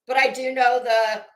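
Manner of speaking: ordinary talk, nothing marked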